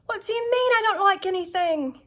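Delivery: high mock British dad; British accent. Imitating her British dad protesting in a mock British accent. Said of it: "So it's more like".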